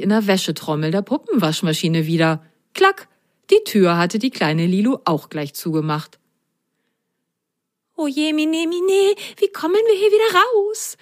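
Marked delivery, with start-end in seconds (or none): 2.7-3.1 sudden high clack; imitated click. A narrator's reading. Narrator voices door clicking shut. 8.0-11.0 very high, wailing sock voice; alarmed mock whisper. A narrator's reading. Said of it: "flüsterte Lili".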